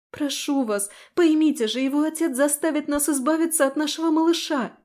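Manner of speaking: high, urgent begging; desperate pleading. Acted. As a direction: plead for the baby's life. Heroine begs the commander.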